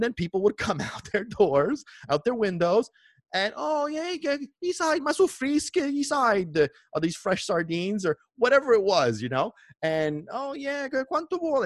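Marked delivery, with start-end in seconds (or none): 3.6-8.0 high excited call; customer calling. Retold or imitated. 10.3-11.6 high voice calling in Italian; customer asking price. Retold or imitated.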